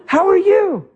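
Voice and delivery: high-pitched sweet reply; uncomplaining mother. Retold or imitated. Mother answering her child from bed, high cheerful imitation. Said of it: "How you doing, Mom?".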